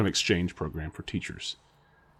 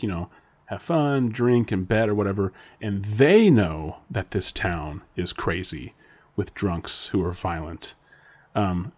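ordinary delivery

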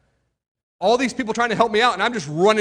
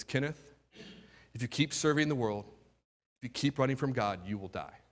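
low, soft and grave warning; a quiet inner voice foretelling doom; hushed, solemn divine warning; hushed voice of God; 6 semitones lower, 11 dB quieter than usual. Retold or imitated. God's voice speaking inside him.

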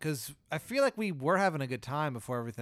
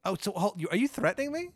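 startled; 8 semitones higher, 3 dB louder than usual. Acted. Car threatens him.

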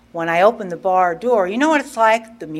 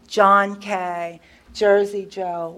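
usual speaking voice

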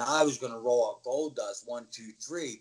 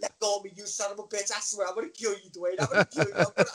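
higher-pitched, hammed-up cursing threat; mock fury, pitched up; angry threat; 7 semitones higher, faster than usual. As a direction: imitate a wrestler seething at her opponent. Luna threatening him, acted out.

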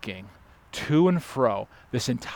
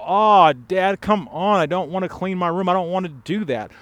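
higher, louder mock-whining child; preacher mimicking his kids' whine; whiny kids; 6 semitones higher, 4 dB louder than usual. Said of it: "and they go".

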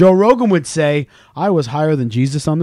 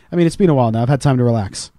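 usual speaking voice

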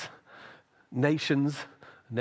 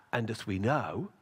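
ordinary talk

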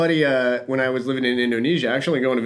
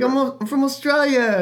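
high, slowed mock Australian accent; put-on Australian voice, much higher; imitated Australian woman; 12 semitones higher than usual. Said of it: "we were meeting these Australian people".

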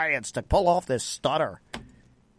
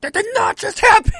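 high, loud stunned outburst; can't believe what just happened; shocked disbelief; 7 dB louder than usual. Retold or imitated. Disbelief at the comeback.

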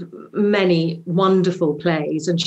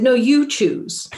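"you" raised high and drawn out; stress on "you"; 6 semitones higher, slower than usual. Retold or imitated. Actress passing the choice back to the director, insistent stress on "you". Retold back-and-forth over choosing song.

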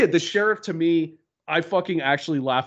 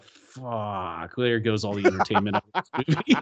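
audible sigh; 6 semitones lower than usual. Retold or imitated. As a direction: re-enact a deflated sigh of disappointment. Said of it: "she just heard me audibly sigh".

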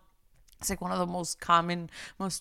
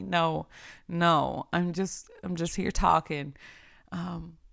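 ordinary talk, nothing marked

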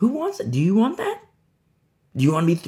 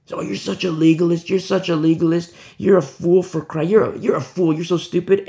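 higher, louder sneering accuser; mocking critic; 4 semitones higher, 4 dB louder than usual. Preacher mimics a scoffer mocking the believer. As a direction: jeer at the believer as a fool. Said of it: "You see? mocking voice of critics".

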